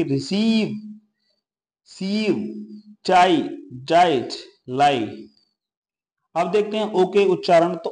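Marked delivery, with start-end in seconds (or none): 0.0-5.3 जाइट and लाई read slowly; slow teacher reading of जाइट, लाई; slow example words. Shown as a demonstration. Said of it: "reading example words".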